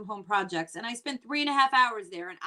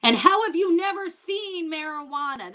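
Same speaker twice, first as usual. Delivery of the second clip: incredulous; 7 semitones higher, 6 dB louder than usual. Retold or imitated. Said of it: "And I'm like".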